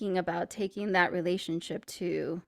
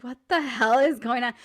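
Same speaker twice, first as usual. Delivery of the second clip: imitated bafflement; 4 semitones higher, 6 dB louder than usual. Cashiers' baffled reaction, acted out.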